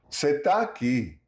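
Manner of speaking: Você tá aqui run together quickly; compressed vowels. Shown as a demonstration. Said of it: "vowel compression inside words".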